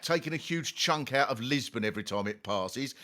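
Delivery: usual speaking voice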